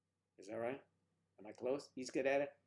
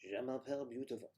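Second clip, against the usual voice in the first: mock French; 3 semitones higher, 4 dB louder than usual. Shown as a demonstration. Showing you can do French, mock French accent. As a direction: put on a playful French accent. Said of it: "You can do French".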